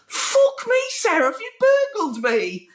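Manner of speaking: high mock disbelief, dropping as it goes. Rock star walking in on redecorated room, disbelieving. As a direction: imitate a stunned rocker, starting very high. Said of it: "he just couldn't believe it".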